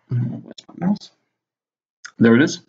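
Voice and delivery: ordinary talk, nothing marked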